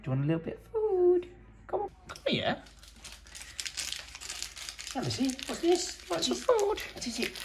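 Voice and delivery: high sing-song voice for a cat; pet voice. Real, not acted. Talking to the cat.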